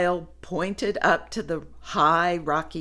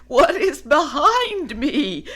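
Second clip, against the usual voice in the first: loud panicky question; shouting; 8 semitones higher, 5 dB louder than usual. Acted. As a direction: call out in alarm. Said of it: "shouted Woolly".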